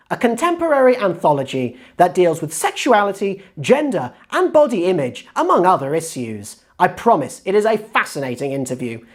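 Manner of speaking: loud, animated presenter with swooping pitch; big, lively host's welcome; show intro. Acted. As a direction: announce your guest to the audience with punchy emphasis.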